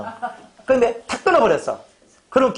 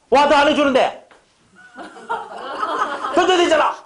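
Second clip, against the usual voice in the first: much higher, louder griping; mock indignant outburst; 8 semitones higher, 3 dB louder than usual. Resentful churchgoer gripes about ungrateful giving, higher and louder. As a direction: whine it with wounded outrage.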